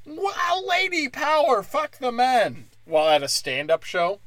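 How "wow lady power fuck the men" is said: much higher, gushing cheer; mock girl-power cheer. Comic mocking women cheering at shows, high. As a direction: squeal it like a smug cheerleader. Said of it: "where women are like".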